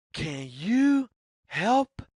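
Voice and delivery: plain reading voice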